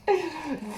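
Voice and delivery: real giggle breaking the reading; laughing at her own slip; burst of laughter. Real, not acted. Narrator cracks up mid-story, laughing.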